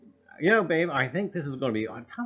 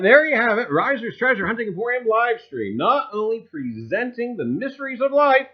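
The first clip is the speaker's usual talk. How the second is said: loud, high showman tagline; announcer tagline; 10 semitones higher, 10 dB louder than usual. Acted. Host pitching his live stream to viewers, loud announcer voice. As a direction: sell the show's slogan like a carnival barker.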